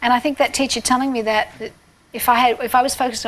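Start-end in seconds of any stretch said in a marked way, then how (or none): none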